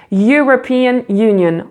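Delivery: "European" begins with a y sound, slow; "European" with a yoo onset; English "European Union". Shown as a demonstration. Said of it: "si on prend les mots European Union".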